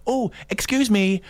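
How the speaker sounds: bright, high polite Oh, excuse me; mock polite driver; notification excuse me. Shown as a demonstration. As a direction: say "excuse me" as a gentle polite nudge. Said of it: "one that sort of says".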